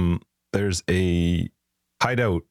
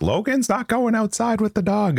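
stern wife. Retold or imitated. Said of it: "Christine was like".

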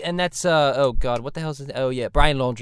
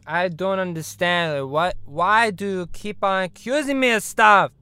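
ordinary talk, nothing marked

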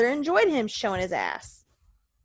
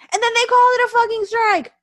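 shouted high and loud in outrage; enraged yell about the strike; furious shout; 12 semitones higher, 9 dB louder than usual. Real, not acted. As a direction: explode at the umpire's call. Ranting about the umpire call.

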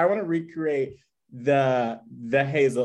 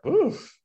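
high crying oof; a high pained oof. Real, not acted. Pained reaction.

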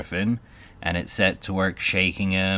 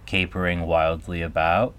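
ordinary delivery, nothing marked

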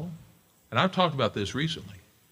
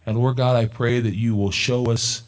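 closing prayer; 4 dB louder than usual. Acted. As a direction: pray over the congregation.

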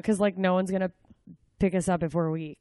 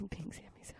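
whispered laugh; 20 dB quieter, much faster than usual. Real, not acted.

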